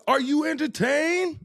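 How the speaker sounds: high, slow mock Gladiator shout; Gladiator quote. Friend quoting The Gladiator to mock being entertainment. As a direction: ham up the arena line, slow and high. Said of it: "like on The Gladiator".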